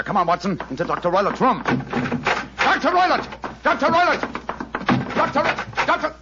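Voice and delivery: high, loud calls through the door; calling out. Acted. Holmes calling out to Doctor Roylott, loud urgent calls. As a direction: shout his name to rouse him.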